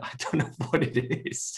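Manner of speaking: baffled, breaking into a laugh; amused confusion, laughing mid-sentence. Real, not acted. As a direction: laugh through your own cluelessness.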